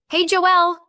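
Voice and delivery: high, loud, sugary fake-cheerful greeting; syrupy. Sister greets Joelle in a syrupy fake-sweet voice. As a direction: gush sweetly like you need a favour. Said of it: "Her voice was syrupy".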